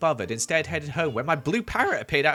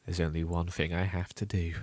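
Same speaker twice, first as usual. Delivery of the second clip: ominous; 7 semitones lower, 5 dB quieter than usual. Retold or imitated. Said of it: "And then I said this".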